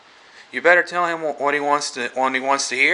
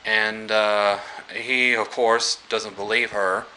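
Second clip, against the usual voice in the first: usual speaking voice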